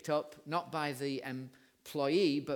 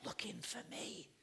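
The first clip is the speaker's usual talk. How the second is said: soft, secretive whispered punchline; little boy's whisper; 7 semitones higher, 11 dB quieter than usual. Acted. Little boy confessing to dad's boss why they are there, whispering. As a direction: whisper like a child who is hiding. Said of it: "and then the little voice says".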